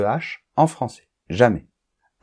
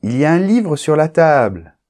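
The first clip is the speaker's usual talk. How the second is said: exclamation; 5 semitones higher, 4 dB louder than usual. Shown as a demonstration. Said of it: "avec une exclamation, on monte".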